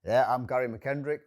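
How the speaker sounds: ordinary talk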